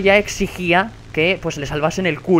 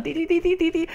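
high, soft repeated beeps; imitated censor beeps; 10 semitones higher, 5 dB quieter than usual. Streamer mimics the censored chat as beeping. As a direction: chirp ti ti ti like a bleep. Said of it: "una especie de código morse".

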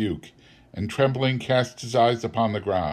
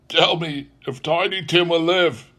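higher, concerned plea; earnest interest; 7 semitones higher than usual. A narrator's reading. Scrooge asks the Spirit about the child, earnestly interested. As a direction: ask it urgently, pitched up. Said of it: "with an interest he had never felt before".

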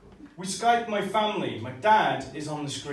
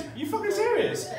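much higher, pitched up on serious; high mock outrage. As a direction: screech it in disbelief. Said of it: "worst thing you can say".

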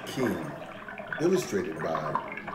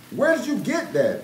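put-on high, louder kids' question; high, eager children's voices; children asking; 6 dB louder than usual. A narrator's reading. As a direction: siblings amazed at the gift. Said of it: "they all asked".

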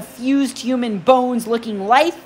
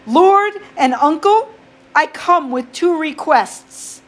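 higher, formal and tense; bravely forced respect; nervous boy voice; 6 semitones higher than usual. Acted. Boy addressing god nervously.

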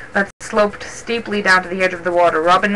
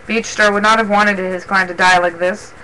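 ordinary delivery

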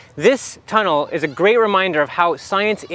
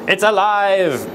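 mad scientist; slower than usual. Pretend. Frankenstein line, first take.

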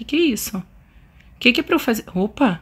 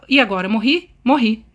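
ordinary talk

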